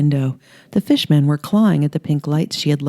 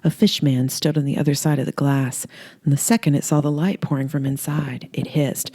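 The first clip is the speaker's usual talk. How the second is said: plain reading voice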